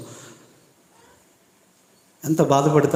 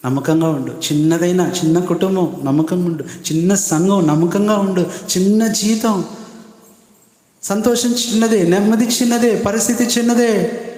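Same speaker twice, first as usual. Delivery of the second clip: rising step by step, preach it higher each phrase; building sermon exhortation; 6 semitones higher than usual. Acted. Preacher urging the congregation to stay faithful, building. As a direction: climb from steady to fervent preaching.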